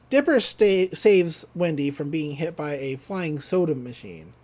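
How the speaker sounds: ordinary delivery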